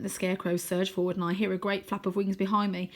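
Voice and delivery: ordinary delivery, nothing marked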